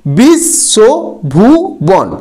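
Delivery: বিশ্বভুবন split slowly into বিশ-শ-ভু-বন; syllable by syllable. Shown as a demonstration. Teacher splitting a word into syllables for students. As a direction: pull বিশ্বভুবন apart syllable by syllable. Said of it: "এখানে বিষ এবং বন হচ্ছে রুদ্ধ দল".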